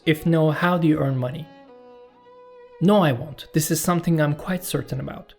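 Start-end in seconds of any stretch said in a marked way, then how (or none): none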